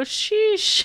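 high, drawn-out Sheesh; exaggerated sheesh. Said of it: "tried really hard not to go".

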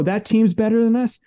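rally yourself like a competitor before a game; the champion's attitude, defiant and driven. Retold or imitated. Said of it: "is you go".

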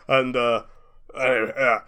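normal talking voice

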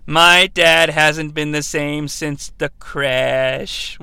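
low, slow mock solemnity; mock movie kid. Recapper imitating a kid in a film, slow mock-grave voice. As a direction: drag it out as a grave confession. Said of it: "in Kindergarten Cop when that kid's like".

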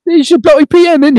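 high, loud mock posh British voice; mock British accent. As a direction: camp up the British Prime Minister. Mocking British PM.

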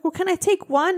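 lightly voiced child asking; imitated little daughter. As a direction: wheedle like a small kid. Mom imitates daughter bargaining.

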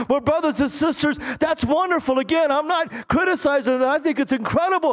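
high, insistent pulpit delivery. Real, not acted. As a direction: press the point high and urgently.

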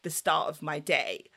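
normal talking voice

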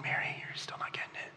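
near-whispered, very soft aside; hushed aside. Acted. Jesus to Mary as she still misses it, hushed. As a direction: murmur it under your breath, barely voiced.

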